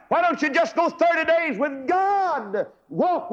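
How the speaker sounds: high, ringing exhortation with a laugh; full-force preaching. Real, not acted. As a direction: revival preacher at full cry. Preaching climax.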